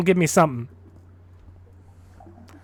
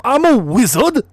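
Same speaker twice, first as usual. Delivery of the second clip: put-on Cockney accent; 5 semitones higher than usual. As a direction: shout it like a Cockney wizard. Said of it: "make Harry speak in a Cockney accent".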